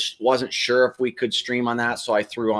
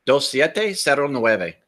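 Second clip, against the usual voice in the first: put-on American accent Spanish; 2 semitones higher, slower than usual. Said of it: "with a gringo accent".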